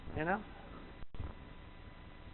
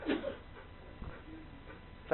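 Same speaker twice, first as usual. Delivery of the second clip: whispering; 22 dB quieter than usual. Real, not acted. Whispered aside during breathing demo.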